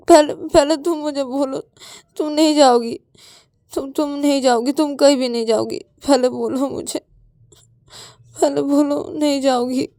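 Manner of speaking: high, crying demand for a promise; whimpering, stubborn plea; tearful insistence. Acted. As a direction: insist through tears. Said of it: "तुम, तुम रो क्यों रहे हो".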